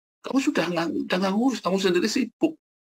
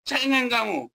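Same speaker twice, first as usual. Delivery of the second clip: high-pitched retold parental rebuke; imitated sharp telling-off, pitched high; retold scolding; 10 semitones higher than usual. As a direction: act out scolding a crybaby child. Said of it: "suaranya kayak gledek".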